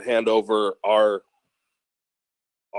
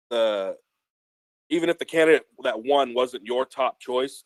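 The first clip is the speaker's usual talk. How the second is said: ordinary talk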